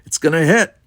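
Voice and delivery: high, loud shout of warning; called-out warning. A narrator's reading. Navigator warning crew of impact, calling out. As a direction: call it out across the room. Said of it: "I called out".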